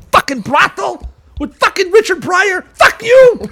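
high, loud playful yell; soaring, loud mock fury; mock outraged yell. Real, not acted. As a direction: yell at your friend in jest. Mock-outraged at his friend.

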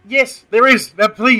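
loud, high, instant retort; emphatic insistence. Real, not acted. Host answers a doubter about football gods, emphatic insistence. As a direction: cut in loudly, insisting on it.

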